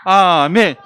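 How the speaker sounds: a shouted, emphatic amen; loud amen. Real, not acted. Teacher and children closing the verse, shouted amen. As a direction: call out amen at full voice.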